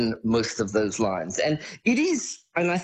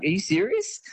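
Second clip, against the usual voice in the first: high-pitched mock disbelief; incredulous squeak of a question. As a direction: pitch it way up, as if you can't believe it. Mimics his disbelief at acting.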